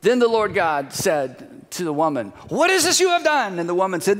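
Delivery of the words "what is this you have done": high, exclaimed reproach; God confronting. Acted. God confronts the woman, reproachful. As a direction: demand an answer, pitch jumping up.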